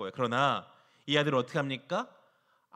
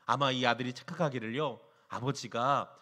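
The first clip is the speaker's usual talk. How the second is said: ordinary delivery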